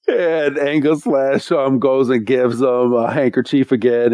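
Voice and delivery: ordinary talk, nothing marked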